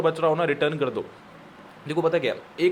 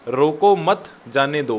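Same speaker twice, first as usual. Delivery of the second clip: रोको मत louder as one unit, then जाने दो; firm "रोको मत", falling "जाने दो"; pause after मत; 4 dB louder, slower than usual. Shown as a demonstration. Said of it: "मत के बाद कॉमा लगा दो".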